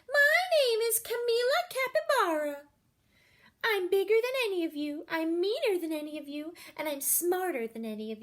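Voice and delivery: high, loud boastful braggart voice, quickening; bossy capybara announcing. Acted. Big new girl bragging loudly to the classroom. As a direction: swagger in and brag to the class. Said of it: "barged into the classroom and announced".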